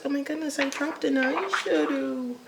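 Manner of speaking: high, slowed "oh my goodness" of dismay; sudden realisation something broke; surprised dismay. Real, not acted. To viewers, showing the cracked palette: high surprised dismay.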